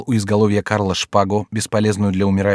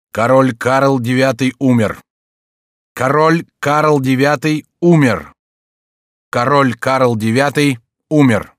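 slow, loud herald's announcement; solemn proclamation; 4 semitones higher, 5 dB louder, slower than usual. A narrator's reading. Captain announcing the king's death from balcony, proclaiming. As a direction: proclaim it word by word, three times.

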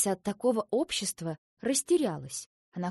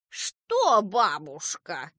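exasperated echo; 5 dB louder, much slower than usual. A narrator's reading. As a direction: throw the word back, swooping down. Grandmother mocks the children's cry.

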